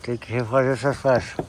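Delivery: talking around a pencil in the teeth; words muffled by a pencil held in the mouth. Real, not acted. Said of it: "Tô falando assim porque eu tô com lápis na boca".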